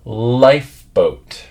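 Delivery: slow, careful "Lifeboat" to copy; slow model word. Shown as a demonstration. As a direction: stretch "Lifeboat" out slowly, pressing lip to teeth for the f. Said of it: "repeat one more time".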